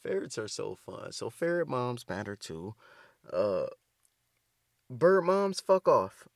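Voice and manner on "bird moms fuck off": loud dismissal. Real, not acted. Dismissing bird owners.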